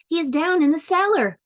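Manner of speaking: high-pitched, excited storybook urgency; urgent alarm. A narrator's reading. Fish owner directs the policeman to the fish, acted urgency.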